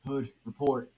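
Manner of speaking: loud gruff order; a loud, gruff demand. A narrator's reading. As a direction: bark the command at him. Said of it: "Batman gruffed".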